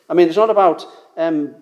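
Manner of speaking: ordinary talk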